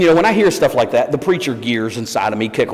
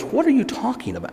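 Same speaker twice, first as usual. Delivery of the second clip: high, softer puzzled question; baffled; 10 semitones higher, 5 dB quieter than usual. Retold or imitated. Actor who has no idea what fans mean, baffled and high. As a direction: imitate bewilderment, voice pitched way up.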